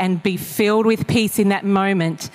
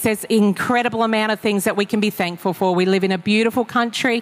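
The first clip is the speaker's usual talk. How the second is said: ordinary talk, nothing marked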